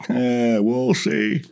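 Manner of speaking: slow, drawn-out doubtful shrug of a line; skeptical old butcher. Retold or imitated. Old butcher doubting the kid's big barbecue gig, slow and skeptical. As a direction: stretch it out like you don't buy a word. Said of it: "And he goes".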